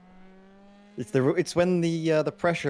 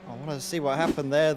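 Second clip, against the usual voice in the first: ordinary talk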